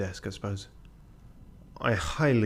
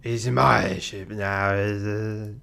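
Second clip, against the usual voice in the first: put-on drunk pirate; slower than usual. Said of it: "Drunk pirate?".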